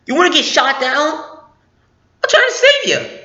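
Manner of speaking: mock frantic urgency; exasperated. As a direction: nag him to run, voice rising to a squeak. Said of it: "'cause it's like".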